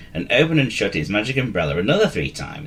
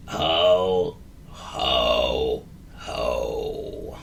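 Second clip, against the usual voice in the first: dreary grunt; 8 semitones lower, 3 dB quieter, much slower than usual. A narrator's reading. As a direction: lower and softer, sinking ho ho ho. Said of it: "grunted Gary drearily".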